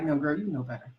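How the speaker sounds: quiet, knowing inner voice; soft inner voice. Retold or imitated. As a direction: murmur it like your own conscience. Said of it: "that inner voice of you that's like".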